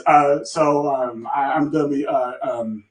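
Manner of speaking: low, soft, stammering request; acting out a reluctant, halting mumble; nervous hesitation. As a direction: stumble timidly over every word, barely audible. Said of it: "I'm saying it like this".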